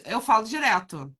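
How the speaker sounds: ordinary talk, nothing marked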